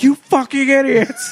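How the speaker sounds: high, loud, drawn-out mock scolding; hammed-up indignant shout; mock outrage. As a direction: yell it like an exasperated listener. Imagined listeners berating the hosts.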